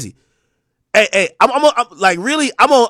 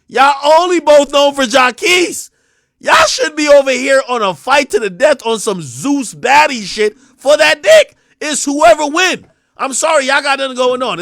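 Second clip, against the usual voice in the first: yelling at them to fight it out; heated rant; 4 semitones higher, 4 dB louder than usual. Real, not acted. Streamer yelling at [name] and [name], loud heated rant. Ranting at the two rappers.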